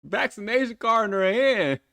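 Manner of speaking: high, slow, drawn-out disbelief; what on earth am I watching; incredulous. Real, not acted. Disbelief at the music video.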